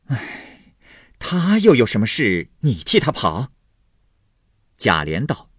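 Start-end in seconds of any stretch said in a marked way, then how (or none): 0.1-3.5 louder, higher puzzled query; sigh, then press for an answer; urgent questioning. Acted. Wife asking why her husband runs errands for her brother, urgently puzzled. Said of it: "凤姐忙问道".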